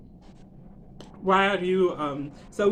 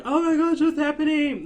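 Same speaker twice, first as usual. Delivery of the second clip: high, louder startled reaction; mock shock; 8 semitones higher, 5 dB louder than usual. Onlookers react to the kissing and crying, mock-shocked. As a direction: act out everyone's alarmed surprise. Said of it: "everyone's like".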